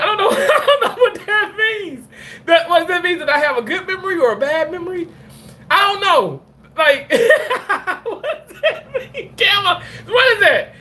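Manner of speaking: high-pitched giggling through the question; laughing confusion. Real, not acted. Streamer laughing at a viewer's elephant-memory comment, high giggles. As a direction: laugh helplessly while asking.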